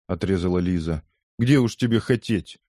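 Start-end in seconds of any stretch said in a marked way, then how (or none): none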